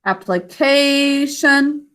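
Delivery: "Application" with a long held KAY, weak ending; stretched syllable demo. Shown as a demonstration. Teacher showing the unstressed ending, long KAY in "Application". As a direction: hold "kay" in "Application", then a light "shun". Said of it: "now the one that's unstressed".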